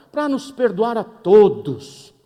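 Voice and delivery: loud, slow preaching; hammed-up preacher boom; preaching climax. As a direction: drive it home from the pulpit.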